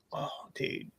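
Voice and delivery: very soft, low aside; hushed awe. Retold or imitated. Pizza fan retelling his reaction to a review, hushed awe. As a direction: drop to a near whisper, impressed.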